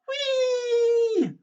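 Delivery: very high, loud swinging whoop; gleeful scream whee. Acted. Granny swinging on a rope, very high loud whee. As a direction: let out a thrilled whee. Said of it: "she screamed".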